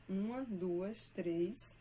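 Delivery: counting syllables slowly, one by one; counting metrical syllables aloud. Shown as a demonstration. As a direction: spell out the beat for students. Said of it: "olha só".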